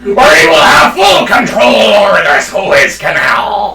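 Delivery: slow, growled buccaneer proclamation; hammed-up sea-dog drawl; pirate voice. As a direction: declare it like a pirate captain, drawn out. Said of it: "It's a pirate voice".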